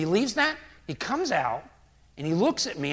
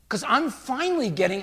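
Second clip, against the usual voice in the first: normal talking voice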